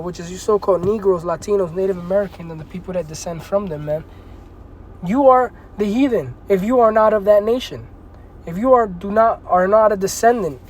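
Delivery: ordinary delivery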